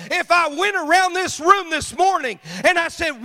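loud, high preaching cadence; full-force preaching. Acted. Preacher thundering to the church, loud and high. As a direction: preach it hard to the congregation.

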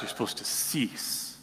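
hushed, breathy aside; mock whisper. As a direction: drop to a whisper to mock the rule-keepers. Religious leaders quoted, mock whisper.

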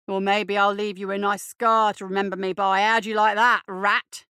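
loud bully, building in force; escalating loud taunt. A narrator's reading. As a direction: raise your voice to menace him. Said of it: "raising his voice".

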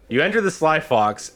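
normal talking voice

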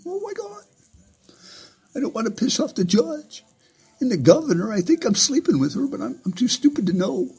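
high, whimpering mock voice of a cowering sheriff; whiny coward.

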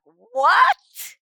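loud startled what; a stunned penitent to the priest, loud and baffled. Retold or imitated. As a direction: react in disbelief. Baffled reaction.

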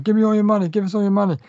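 mock stick-up demand, half-hearted; robbers demanding money. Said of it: "And they're just going".

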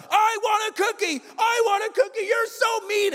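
put-on very high kid's wail; screaming tantrum. Kid rolling on the ground screaming at parent, tantrum. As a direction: throw a hammed-up child's tantrum. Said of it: "Screaming at the top of their lungs".

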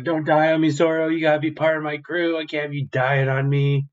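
high, loud pleading cry; desperate plea. Retold or imitated. Luffy begging collapsed Zoro to stay alive, loud pleading. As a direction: beg your friend not to die.

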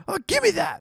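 very high cartoon voice; squeaky grabby cartoon kid. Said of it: "he's like".

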